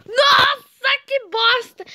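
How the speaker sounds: high, loud exasperated shout; loud cry of disgust at losing; frustrated outburst. Real, not acted. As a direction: burst out cursing at the game. Fails in the game, swears.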